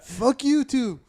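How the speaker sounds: hammed-up comic defiance; taunting fighter. Fighter cursing a second cartoon rival, high mock taunt.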